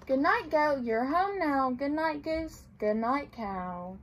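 gentle storybook read-aloud; reading to children. A narrator's reading. Librarian reading a picture book to children, gently.

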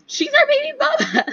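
high squeal through laughter; laughing. Real, not acted. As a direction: giggle out the words, defending her. Defending the baby mama, laughing.

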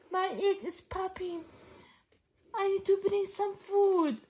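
plain reading voice

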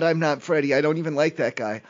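normal talking voice

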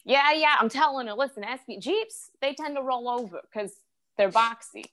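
high-pitched, insistent family lecture; imitated warning relative. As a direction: break in and explain the danger as a fussing relative. Relative voiced warning about Jeeps.

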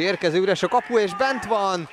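excited goal call. Real, not acted. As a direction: burst out as the ball goes in. Commentator calls a goal. (izgatott gólkiáltás)

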